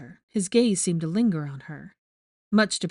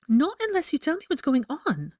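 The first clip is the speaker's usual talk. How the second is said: high, insistent refusal; stubborn demand; 9 semitones higher than usual. Acted. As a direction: she won't budge until he explains. Hermione refusing to leave.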